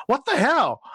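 high-pitched, incredulous outburst; astonished reaction. Retold or imitated. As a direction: retell your own baffled double take. Retells own astonished reaction to tweet.

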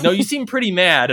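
high, loud puppet voice; questioning sock puppets. Retold or imitated. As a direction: needle him as a puppet. Other puppets questioning him.

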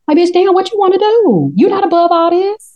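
exasperated scolding. Real, not acted. Viewer scolding the princess, exasperated and high. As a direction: scold her like she should know better.

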